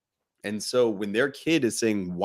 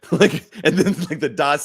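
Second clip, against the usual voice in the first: laughing; 6 semitones higher, 5 dB louder than usual. Real, not acted. Laughing as dots connect.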